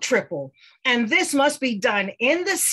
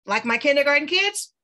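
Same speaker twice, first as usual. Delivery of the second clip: usual speaking voice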